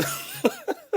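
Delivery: quiet high laugh; laughing along at the end of the dad story; soft laugh. Real, not acted. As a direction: let a soft laugh slip out.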